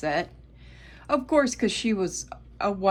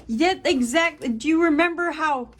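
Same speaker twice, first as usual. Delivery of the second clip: excited; 9 semitones higher, 6 dB louder than usual. Real, not acted. Agreeing eagerly with the cards.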